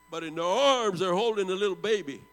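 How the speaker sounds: ordinary delivery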